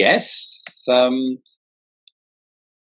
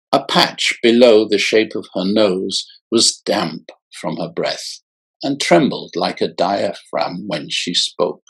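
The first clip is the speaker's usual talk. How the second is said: ordinary delivery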